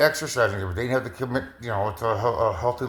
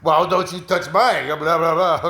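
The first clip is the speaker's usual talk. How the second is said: laughing friend; 4 semitones higher, 4 dB louder than usual. Retold or imitated.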